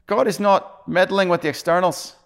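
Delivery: put-on high, loud Scottish accent. Pastor quoting a Scottish preacher to the congregation, Scottish accent. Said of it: "in his Scottish accent".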